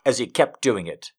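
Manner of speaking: plain reading voice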